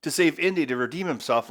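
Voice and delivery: ordinary delivery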